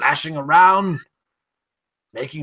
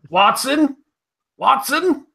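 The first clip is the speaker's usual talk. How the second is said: hopeful call; 8 semitones higher than usual. Acted. Calling to find Watson.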